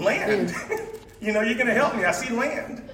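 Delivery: high, laughing cry of spotting land; excited discovery. Retold or imitated. Stranded boat guy laughing as he spots land. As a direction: shout it like a castaway who sees land, giggling. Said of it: "the guy in the boat is thinking".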